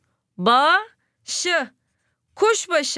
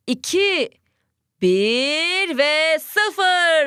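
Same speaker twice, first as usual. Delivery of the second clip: countdown; 3 semitones higher, much slower than usual. Shown as a demonstration. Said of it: "Süremiz on saniye başladı".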